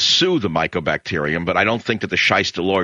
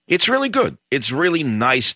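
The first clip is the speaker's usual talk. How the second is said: sarcastic; 8 semitones higher than usual. Real, not acted. Sarcastic praise of immigration.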